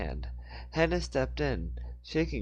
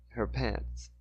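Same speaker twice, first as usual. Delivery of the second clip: usual speaking voice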